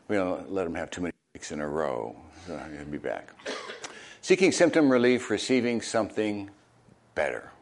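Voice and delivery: ordinary delivery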